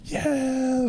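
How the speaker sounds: high mock cheer; a gleeful neighborhood yeah; cheering neighbor. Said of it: "they're just like".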